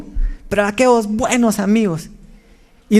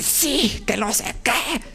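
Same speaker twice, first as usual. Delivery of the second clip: mock complaining; 11 dB quieter, slower than usual. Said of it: "gente que llega a reclamar".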